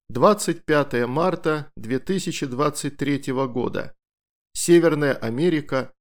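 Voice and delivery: ordinary delivery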